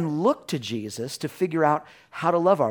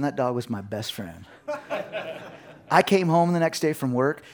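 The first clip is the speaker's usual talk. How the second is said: ordinary talk, nothing marked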